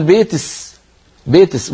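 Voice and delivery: "بيتس" with the ending "ك" swapped for an "س" sound; kaskasa s. Shown as a demonstration. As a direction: end "بيتس" on a hissed "س". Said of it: "بالسين يقول". ("بيتس" بإبدال الكاف سينًا)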